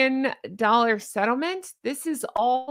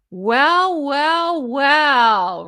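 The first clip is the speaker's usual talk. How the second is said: drawn-out gloating well well well; 5 semitones higher, 6 dB louder, much slower than usual. Real, not acted. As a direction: savour each well, gloating. Gleeful reaction to the news.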